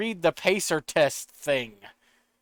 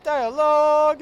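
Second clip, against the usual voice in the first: much higher and louder, a mock-pained cry on the word; exasperated squeal; 12 semitones higher, 8 dB louder, slower than usual. Real, not acted. Streamer mock-wailing at repeated game dialogue, high louder squeal. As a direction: wail it like the dialogue is torture.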